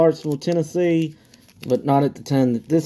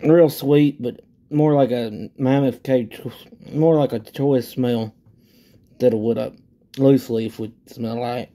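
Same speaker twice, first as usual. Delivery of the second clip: talking while sniffing plug; speaking into object at lips; tobacco plug held at mouth. Real, not acted. Seen 0: Plug pressed to nose and mouth while smelling; no bite seen.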